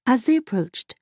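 ordinary delivery

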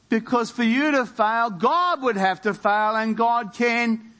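high, insistent rhetorical questions, key words stretched; rally the congregation with each question; rousing preaching. Real, not acted.

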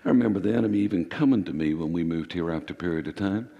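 normal talking voice

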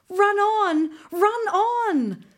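high, loud urgent cry; urgent urging. A narrator's reading. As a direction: urge the horse on, fast.

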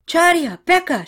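high, loud name calls; acted yell, pitched well up. A narrator's reading. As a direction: shout after friends heading into danger. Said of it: "she yelled out".

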